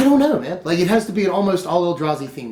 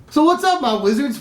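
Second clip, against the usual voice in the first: excited greeting; 8 semitones higher than usual. Real, not acted. Greets wizard players excitedly.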